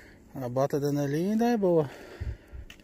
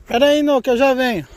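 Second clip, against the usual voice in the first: calling out; 8 semitones higher, 9 dB louder, faster than usual. Real, not acted. Calling back to a companion.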